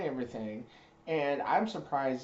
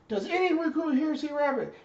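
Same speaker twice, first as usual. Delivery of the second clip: barked shout, way up high and loud; high, loud drill instructor shout; drill instructor yell; 9 dB louder than usual. Retold or imitated. Drill instructor addresses platoon.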